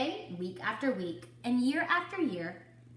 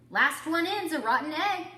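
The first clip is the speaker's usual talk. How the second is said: chirpy taunt; 8 semitones higher, 7 dB louder than usual. A narrator's reading. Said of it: "chipped a chick".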